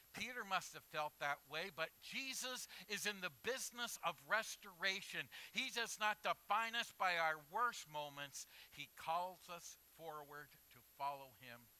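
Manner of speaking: loud, rising preacher's voice; full-force preaching. Acted. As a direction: drive the point home to the church. Preaching to the congregation.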